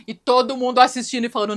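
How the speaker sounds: ordinary delivery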